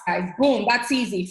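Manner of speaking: normal talking voice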